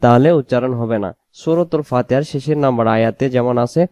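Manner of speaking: usual speaking voice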